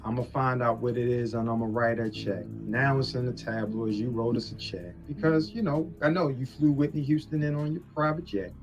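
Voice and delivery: ordinary talk, nothing marked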